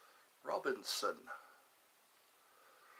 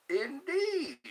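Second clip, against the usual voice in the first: high, put-on British "Indeed"; playful British accent; 10 semitones higher than usual. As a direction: say indeed like a Brit. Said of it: "That's how they talk".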